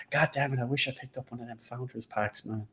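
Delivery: hushed mock self-reproach; mock regret. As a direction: grumble it under your breath as a regretful latecomer. Voices a future buyer's regret.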